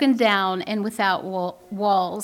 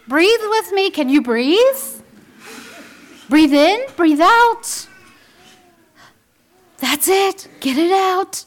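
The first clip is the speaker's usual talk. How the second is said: mocking gentle parent; 4 semitones higher, 6 dB louder than usual. Influencer mom coaxing her raging child, mocking sweet coo. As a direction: send up an influencer mom soothing a tantrum. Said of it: "You will hear them say".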